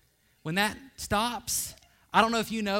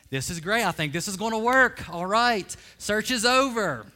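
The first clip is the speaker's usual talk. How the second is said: put-on rising, louder delight at a thrifty girlfriend; excited inner voice; 4 semitones higher, 7 dB louder than usual. Young man thrilled his date wants to save money, excited. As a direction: gush like you just found the one.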